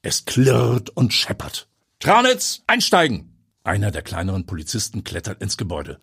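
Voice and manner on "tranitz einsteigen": high, loud order; shouted command. Acted. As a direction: bark it at the officer. Police chief barking orders.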